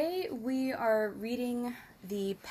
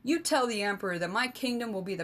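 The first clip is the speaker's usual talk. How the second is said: fast, louder heated retort; angry king; 3 dB louder, much faster than usual. Acted. King retorting to the mocking emissary, fast and angry. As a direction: fire it back, barely holding his temper.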